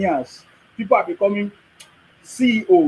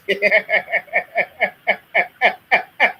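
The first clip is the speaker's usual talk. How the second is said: loud laughter; 3 semitones higher, 4 dB louder than usual. Real, not acted. Laughing at complainers.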